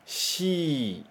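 "Shi" said as the Japanese syllable "shi", not English "si"; "Shi" with sh, like the Japanese letter name for C. Shown as a demonstration. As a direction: model "Shi" with a soft sh sound for students to echo. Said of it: "Mais uma vez".

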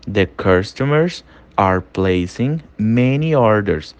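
slow and clearly spaced for learners to repeat; slow drill read of "The customers are placing many orders"; slow model sentence. Shown as a demonstration. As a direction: spell it out slowly, word by word. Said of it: "Tudo que eu falar em inglês, você vai ler e você vai repetir".

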